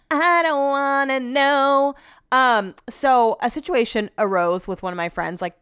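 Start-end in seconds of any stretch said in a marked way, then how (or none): 0.1-1.9 drawn-out, higher mock wail; slow, sing-song whining protest; dramatic whine. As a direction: stretch every word in petulant refusal. Dramatic repeat of her refusal.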